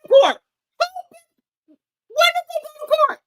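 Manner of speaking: very high, very loud outraged shriek; shrieking disbelief. Real, not acted. Hearing the court claim, she shrieks in outrage. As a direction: scream the question in disbelief.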